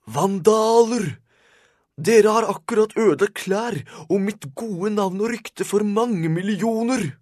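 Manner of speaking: very high, loud outraged shriek; old man screaming his head off; old man's furious scream. A narrator's reading. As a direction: scream at the vandals, stretching the key words. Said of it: "skrek så høyt at hatten".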